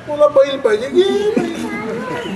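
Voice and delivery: put-on high, drawn-out giving-in to a child; indulgent offer. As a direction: stretch it out like handing a toy over. Coaxing a child, offering choice.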